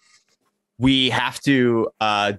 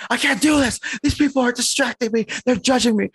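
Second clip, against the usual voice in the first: whiny diva; faster than usual. Retold or imitated. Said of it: "He's like".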